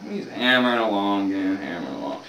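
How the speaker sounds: ordinary talk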